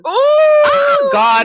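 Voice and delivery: loud laughing cry, high up; laughing shout. Real, not acted. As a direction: blurt it out through a big laugh. Laughing outburst at own joke.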